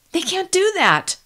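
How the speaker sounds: high, outraged cry; furious outburst at the TV; outrage. Acted. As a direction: protest it full of outrage. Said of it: "My voice was filled with outrage".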